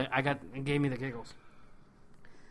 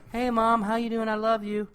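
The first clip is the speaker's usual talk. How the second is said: higher-pitched sweet phone greeting; lightly acted fond hello; mock doting son; 6 semitones higher, 4 dB louder than usual. Dude on the payphone talking to his mom, lightly mocked fondness.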